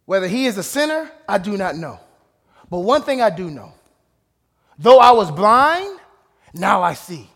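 blind man's bold answer to the leaders; blind man speaking up. Retold or imitated. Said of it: "this is how I would talk".

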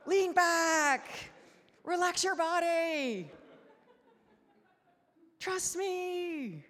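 high, drawn-out mock shouts; comic high yelling, like coaching a swimmer; God yelling instructions. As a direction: holler each phrase with a pause after it. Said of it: "God's like".

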